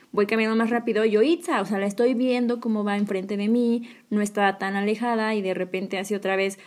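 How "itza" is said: calling out again. Retold or imitated. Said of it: "le vuelvo a, a gritar".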